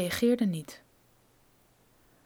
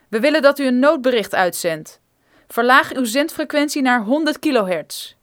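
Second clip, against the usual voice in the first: firm order; 2 semitones higher, 7 dB louder than usual. Acted. Armed man commanding the geologist.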